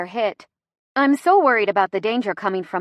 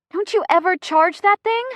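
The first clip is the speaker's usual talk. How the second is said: exasperated scolding; 10 semitones higher, 5 dB louder than usual. Acted. Laboring woman scolds.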